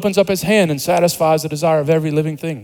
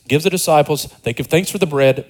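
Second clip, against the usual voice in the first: ordinary talk, nothing marked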